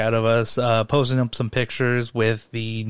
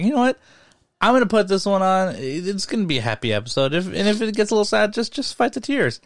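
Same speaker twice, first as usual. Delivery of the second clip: high-pitched mock determination; retold self pep talk; 9 semitones higher, faster than usual. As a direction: talk yourself into it, pitched up and hurried. Acting out own hopeful pep talk.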